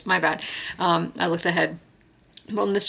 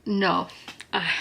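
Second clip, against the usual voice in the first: normal talking voice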